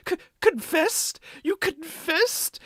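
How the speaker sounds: slow, high-pitched stammer of shock; stunned disbelief. Retold or imitated. Tamaki taking in that she confessed, stunned. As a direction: draw it out in hammed-up astonishment. Acted shock before he freaks out.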